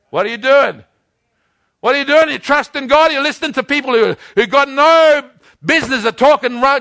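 loud, high, insistent repeated questioning; full-force preaching challenge. Real, not acted. Preacher demanding whom they are trusting, at full force. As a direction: confront the congregation, pounding out each question.

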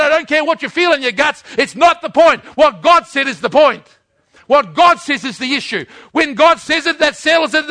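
loud, high-pitched, emphatic sermon delivery; full-force preaching. Real, not acted. Preacher declaring that God doesn't lie, at full force. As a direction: preach it hard, driving each declaration home.

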